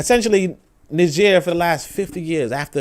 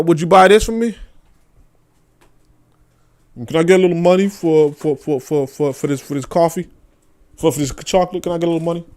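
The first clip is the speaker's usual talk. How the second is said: lowered, meek pleading voice; mock begging. The colony begging Britain for money, meek and pleading.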